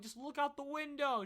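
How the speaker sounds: high, loud plea down the phone; retold frantic yell; frantic shouting. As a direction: shout it in mock panic. Retold frantic call to mom.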